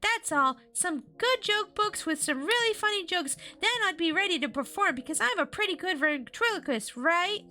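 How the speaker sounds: put-on high-pitched hopeful girl; Chris. A narrator's reading. Chris convincing Cody she's good, high girlish voice. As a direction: plead your case in a high voice. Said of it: "she said".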